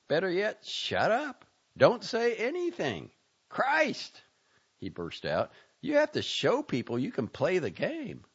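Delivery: exasperated friend scolding a dreamer; Jack's exasperated rant. Acted. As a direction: scold him like a fed-up friend. Narrator voices Jack's rant.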